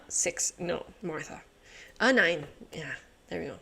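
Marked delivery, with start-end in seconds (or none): none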